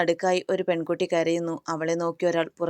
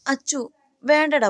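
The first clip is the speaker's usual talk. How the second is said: urgent plea; 9 semitones higher, 5 dB louder than usual. Acted. Friend restrains angry Akash.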